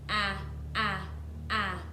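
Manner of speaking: "Ah" said as a short clipped a sound, repeated. Shown as a demonstration. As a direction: sound out "ah" like the start of apple, three times. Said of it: "A says ah".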